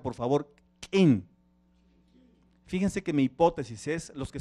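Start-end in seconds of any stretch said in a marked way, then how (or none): 0.8-1.3 "kin" with the Tzeltal glottal catch on the k; glottalized kin. Shown as a demonstration. Speaker teaching a Tzeltal word: "kin" with a glottal k. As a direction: clip "kin" with a glottal stop. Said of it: "Traten de pronunciarlo".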